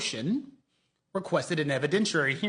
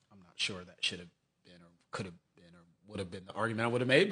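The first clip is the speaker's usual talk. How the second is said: ordinary talk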